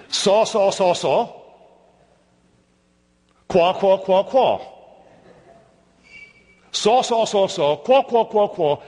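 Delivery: chanted Hebrew syllables in a Scottish accent. Shown as a demonstration. Preacher demonstrating the repeated Hebrew words, Scottish accent. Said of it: "I'll give you the Hebrew in a Scottish accent".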